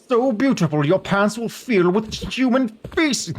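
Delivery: graphics hog voice. Pretend. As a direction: hype it like a tech commercial. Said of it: "the graphics hog".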